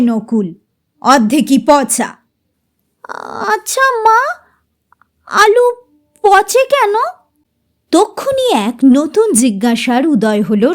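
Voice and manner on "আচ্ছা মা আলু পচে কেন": put-on high childish question; a girl asking, voice lifted; curious child. Child asks again. (শিশুর কৌতূহলী প্রশ্ন)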